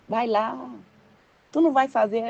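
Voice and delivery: mild, coaxing request; gentle voice. Acted. God gently asking the believer to go, gentle voice. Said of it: "ele fala com aquela voz mansa".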